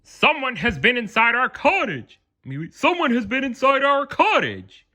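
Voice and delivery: high, put-on Papa Bear voice, a touch alarmed. A narrator's reading. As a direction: play the father bear finding his door open. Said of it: "Papa Bear said".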